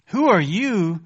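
loud, sharp challenge; booming rebuke. Acted. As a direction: thunder the question down at them. Preacher rebukes questioning man.

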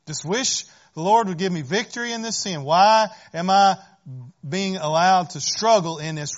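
loud exasperated plea; frustrated complaint. Pretend. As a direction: cry out why me, pushing hard. Voicing frustrated believer's complaint.

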